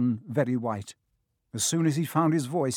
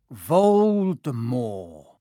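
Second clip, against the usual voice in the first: dreaded name; 4 semitones lower, much slower than usual. A narrator's reading. Hagrid forced to say the name.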